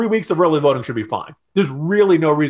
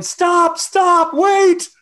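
high-pitched, louder frantic cry; comic panicked shout, far above usual pitch; mock yelling; 6 dB louder, much slower than usual. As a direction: yell as if running in to halt everything. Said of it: "running in yelling".

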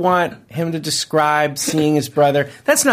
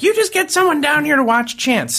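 high-pitched mock crying voice; imitated tearful plea; 12 semitones higher than usual. Wife in labor demanding a sitter, high imitated tearful voice. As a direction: imitate an upset wife pleading. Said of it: "She was starting crying".

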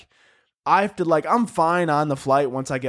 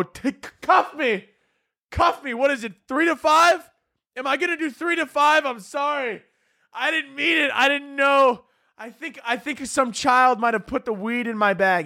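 hammed-up hysterical pleading; 9 semitones higher than usual. Begging the agent hysterically.

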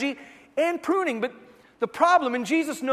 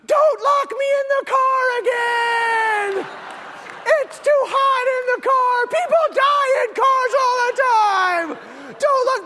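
high, loud, drawn-out mock hysteria; slow, piercing tantrum plea; imitated child screaming; 14 semitones higher, 6 dB louder, slower than usual. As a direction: scream like a toddler pleading for the whole store to hear. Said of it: "his tune changes".

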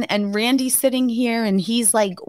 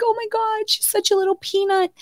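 tender coo; 12 semitones higher than usual. Retold or imitated. First sight of newborn daughter.

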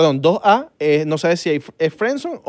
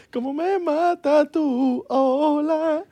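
high, sing-song flirty come-on; mock seductive voice; 12 semitones higher than usual. Friends parodying flirty texts, high sing-song mock come-on. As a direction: ham up a cheesy pickup line.

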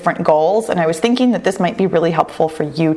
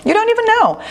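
exaggerated cartoon intonation; 14 semitones higher, faster than usual. Real, not acted. Teasing the listener.

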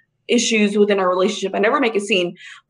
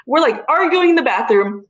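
ordinary talk, nothing marked

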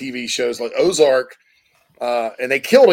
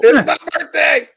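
much higher, louder mock birthday shout; playful birthday cheer; 13 semitones higher, 5 dB louder than usual. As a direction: squeal it like an excited birthday boy, in jest. Jokey quote of birthday boy.

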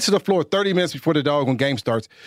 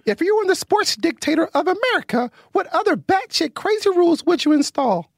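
son's voice; 12 semitones higher than usual. Pretend. Dad reading the question in his son's high voice. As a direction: read the question like a toddler. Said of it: "my son is so bad".

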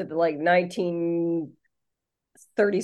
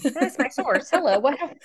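high, put-on goofy voice; lightly voiced silly character; 6 semitones higher than usual. Joking about fake sources.

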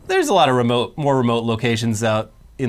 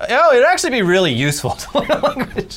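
laughing; 9 semitones higher than usual. Retold or imitated. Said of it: "And now I'm like".

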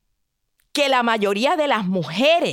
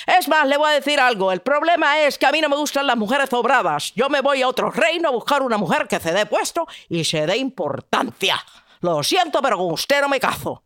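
dismissive prince; 2 semitones higher, faster than usual. Acted. Prince rejects the maiden.

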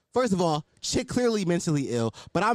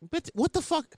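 incredulous; 12 semitones higher than usual. Retold or imitated. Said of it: "I'm like".

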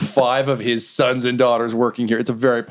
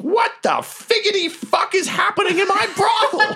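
furious boss shout. Acted. Brothel owner storming in, shouting furiously. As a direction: roar it across the room.

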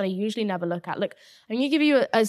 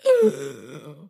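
sharp frightened gasp; startled gasp; 6 semitones lower, 4 dB louder than usual. Retold or imitated. Woken by the barking dog, a retold startled gasp. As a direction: gasp as if jolted awake.

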